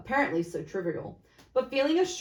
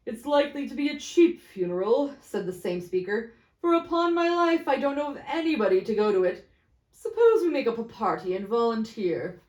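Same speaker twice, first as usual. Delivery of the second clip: mocking merchant; 3 semitones higher, 5 dB louder than usual. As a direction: scoff at the cheap funeral. Same speaker.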